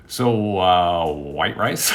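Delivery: low-pitched, unimpressed question; low deadpan check after a long rant; deadpan nephew. Retold or imitated. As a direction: ask dryly, imitating an unmoved nephew.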